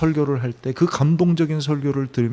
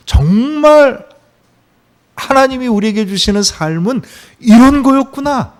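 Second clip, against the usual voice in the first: rising, louder, stretched cry of discovery; sudden realization; 7 semitones higher, 6 dB louder than usual. Acted. Preacher voicing Paul's awakening, growing excited realization. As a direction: voice climbs as the truth dawns.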